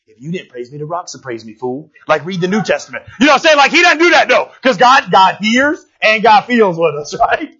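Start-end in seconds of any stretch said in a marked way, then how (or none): 0.1-1.9 low, very soft mock rebuke; playful put-down, hushed and low; muttered chiding. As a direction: mutter it low under your breath. Imagined Jesus walking by, chiding.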